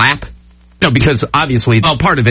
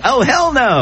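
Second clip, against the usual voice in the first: higher mock Brad voice, hammed-up; imitating Brad; 9 semitones higher, slower than usual. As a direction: squeak it out like a driver seeing a flying shark. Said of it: "What was that voice?".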